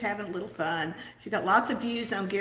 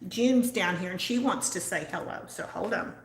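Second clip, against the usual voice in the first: ordinary delivery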